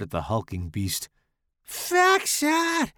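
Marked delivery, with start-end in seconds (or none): 1.6-2.9 high piercing shriek. A narrator's reading. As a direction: shriek the order, pitched way up. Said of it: "Larry shrieked".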